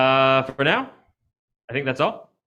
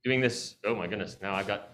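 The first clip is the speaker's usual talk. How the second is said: ordinary talk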